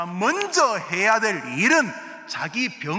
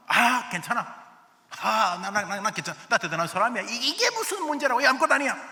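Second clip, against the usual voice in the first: higher, stammering brag; mock boasting; 5 semitones higher than usual. Self-deceived man in Revelation, stammering mock bravado. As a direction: puff up and insist it's nothing. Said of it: "이랬다는 것입니다".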